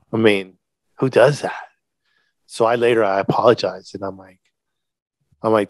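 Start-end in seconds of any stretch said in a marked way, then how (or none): none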